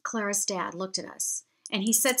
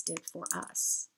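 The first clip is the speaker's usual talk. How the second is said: teary-eyed; 3 semitones lower, 10 dB quieter than usual. Real, not acted. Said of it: "I'm getting teary-eyed now".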